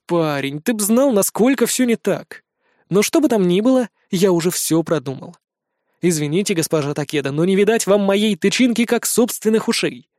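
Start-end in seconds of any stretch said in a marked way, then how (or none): none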